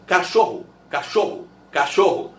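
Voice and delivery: "cachorro" ending in an u sound, "cachorru"; final o as u. Shown as a demonstration. Teacher showing the final o of cachorro. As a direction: repeat "cachorro" three times with the last o as u. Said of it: "esse O final tem som de U".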